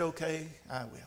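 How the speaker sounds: soft, low resigned acceptance; hushed storybook resignation; resigned chicken. A narrator's reading. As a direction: let it drop quietly, as if giving up on help. Chicken resigned after everyone refuses.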